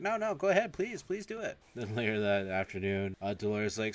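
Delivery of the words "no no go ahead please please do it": high-pitched put-on woman's voice; pleading female brain.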